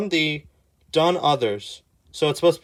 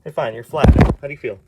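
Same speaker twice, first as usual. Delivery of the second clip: ordinary talk, nothing marked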